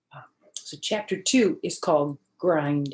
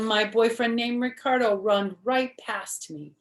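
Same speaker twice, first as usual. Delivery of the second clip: usual speaking voice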